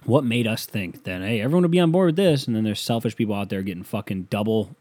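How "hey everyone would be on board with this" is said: quick, high mock-cheerful quote; mock upbeat announcement; naive optimism. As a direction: chirp it like a clueless optimist.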